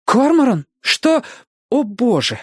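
high, startled woman's voice; shocked at what she sees; alarmed. A narrator's reading. Robin sees the struggle.

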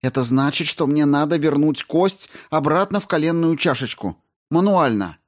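high, pressing explanation to a patient in shock; urgent warning. Acted. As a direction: make her grasp what is coming. Rao urgently warns convulsing patient. (встревоженно, с нажимом, повыше)

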